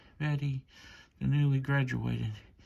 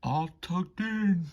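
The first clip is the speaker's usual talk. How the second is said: higher porter voice calling out; lightly voiced porter calling; 6 semitones higher, 3 dB louder than usual. A narrator's reading. Porter calling to sleepy passengers, higher voice. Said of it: "the porter cries".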